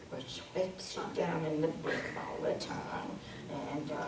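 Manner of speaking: breathy whisper, barely voiced. Shown as a demonstration. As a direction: whisper it close to the ear.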